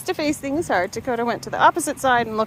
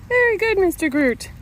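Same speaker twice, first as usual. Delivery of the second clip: high bright dog praise; talking to a dog; 8 semitones higher, 4 dB louder than usual. Real, not acted.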